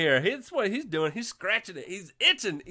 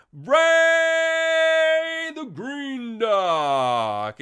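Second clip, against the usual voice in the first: name stretched very slow, louder and higher; drawn-out announcer call; 7 semitones higher, 8 dB louder, much slower than usual. Acted. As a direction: hold every word like a ring announcer. Calling out chat names.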